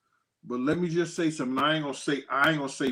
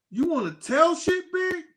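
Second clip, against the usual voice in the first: taunting; 14 semitones higher than usual. Retold or imitated. Taunting [name] in front of [name].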